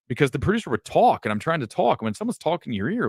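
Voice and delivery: ordinary talk, nothing marked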